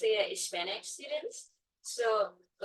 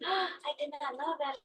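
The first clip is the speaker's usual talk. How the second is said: higher, mildly amazed imitation of kids; excited kids; 5 semitones higher, 4 dB quieter than usual. Kids learning facts about sea animals, mildly excited. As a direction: mimic a child's excited discovery. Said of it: "like, all excited".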